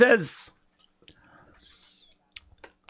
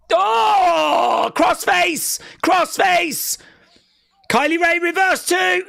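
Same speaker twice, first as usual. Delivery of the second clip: excited shout; 13 semitones higher, slower than usual. Real, not acted. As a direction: hype the reversal. Big submission reversal.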